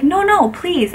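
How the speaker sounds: high-pitched mock pleading; imitating insistent lady.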